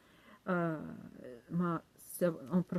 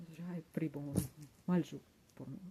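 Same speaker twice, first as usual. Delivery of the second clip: ordinary talk, nothing marked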